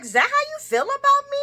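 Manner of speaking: very high, loud hurt question; offended Scotty. Retold or imitated. Scotty asking Lucy how she feels, very high and hurt. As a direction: take it personally.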